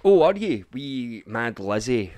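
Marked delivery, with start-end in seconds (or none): 0.0-0.6 loud, high mock surprise; Scottish vlogger sneering back at the clip, loud mock surprise. Real, not acted. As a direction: fake astonishment at her claim. Mocking retort at politician clip.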